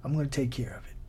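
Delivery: hushed, calming reassurance; quiet and soothing; inside voice. Acted. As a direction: speak very softly, like a voice within. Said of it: "It's an inside voice that says".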